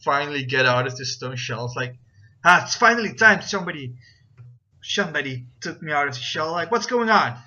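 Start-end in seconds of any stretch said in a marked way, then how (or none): 2.4-7.4 put-on loud, quick German-accented grumble; lightly put-on German accent, louder and quicker. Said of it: "he's, like, in his German accent".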